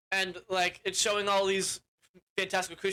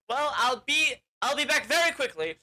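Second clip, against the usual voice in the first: higher, louder, overly confident; breezy promise; 6 semitones higher, 4 dB louder than usual. Retold or imitated. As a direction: promise it like it'll take a minute. Unicorn reassures the animals.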